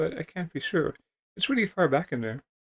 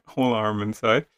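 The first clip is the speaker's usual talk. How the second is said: ordinary talk, nothing marked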